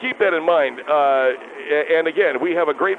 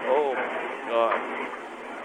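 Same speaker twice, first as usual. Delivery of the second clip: slow exasperated groan; 6 semitones lower, 9 dB quieter, much slower than usual. Acted. Caller groans at the plan.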